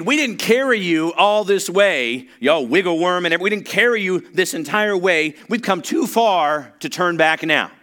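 loud, high, slow preaching of the friends' vow; determined shout. Acted. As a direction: proclaim it like the friends refusing to quit.

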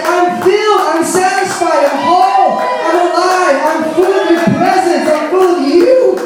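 high, elated declaration; satisfied child. Acted. Preacher voices sons and daughters to a good father, elated declaration. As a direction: proclaim it high and loud, phrase after phrase. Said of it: "the sons and daughters say".

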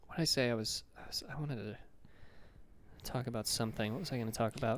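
very soft, low mumble; muttering to himself. Real, not acted. Speaker who lost his thread, muttering to himself. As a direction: mutter while hunting for the lost thought.

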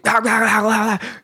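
high, gibberish drill-instructor barks; imitated barking. Instructors barking at recruits outside, imitated barking. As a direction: bark wordless syllables like an instructor.

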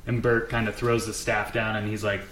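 ordinary talk

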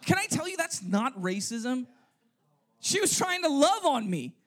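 usual speaking voice

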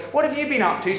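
mock eager question. Imagined friendly question.

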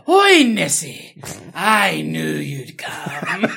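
loud, slow hammed-up Scottish shout with a laugh; Scottish voice calling out, drawn out; mock Scottish call. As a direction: bellow to the sea monster in a fake brogue. Mock Scottish film line.

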